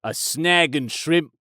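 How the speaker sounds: hammed-up mock Australian accent. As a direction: lay on a cartoon Aussie voice, mate. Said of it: "If you go to Australia".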